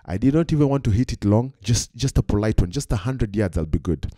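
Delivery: ordinary delivery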